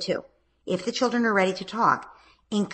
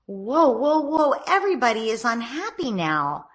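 calm interrupting parent; 5 semitones higher than usual. Shown as a demonstration. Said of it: "You'd say".

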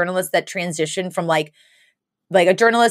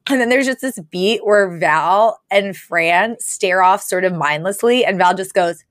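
ordinary delivery

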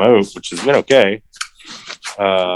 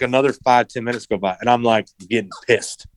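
normal talking voice

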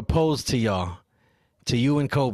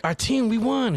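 excited; 10 semitones higher, slower than usual. Retold or imitated. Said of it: "And I'm like".

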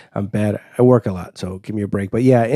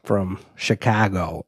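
slow, softer sign-off; deliberately slow; 3 semitones lower, 5 dB quieter, much slower than usual. Shown as a demonstration. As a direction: drag it out slowly and quietly. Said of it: "Let me slow down".

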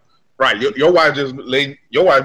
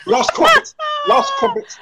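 much higher, laughing posh English falsetto; mock English accent. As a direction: squeal it like a posh Brit in distress. Mocking English posh speech.

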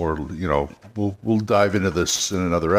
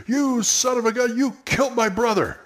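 pitched-up outraged cry; high angry accusation; 13 semitones higher than usual. Shown as a demonstration. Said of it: "one of the P Cs came up and said".

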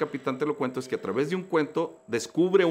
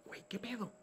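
much softer, muttered what-the-hell; quiet dismay; 11 semitones higher, 16 dB quieter, faster than usual. Retold or imitated. Speaker asking himself what happened after losing everything, softly. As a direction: say it almost under your breath.

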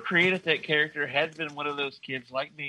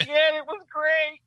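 mock Green Lantern; 13 semitones higher, 4 dB louder than usual. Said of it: "He goes".

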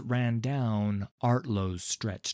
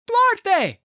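loud high call across the room; shouting; 11 dB louder than usual. A narrator's reading. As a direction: yell his name toward the door. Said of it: "He raised his voice, shouting".

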